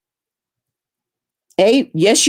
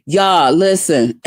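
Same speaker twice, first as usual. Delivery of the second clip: ordinary talk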